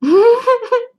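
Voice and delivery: loud, high-pitched laugh. Real, not acted. Laughing at stream chat.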